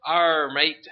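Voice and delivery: loud Australian pirate arr; Australian pirate growl; playful Australian pirate accent. Pretend. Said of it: "me trying to do a pirate accent".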